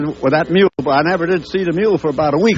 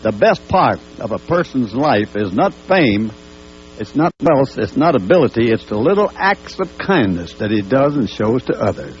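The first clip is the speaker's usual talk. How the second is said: reading a written tribute; 4 semitones lower than usual. Real, not acted. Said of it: "I wrote something down here".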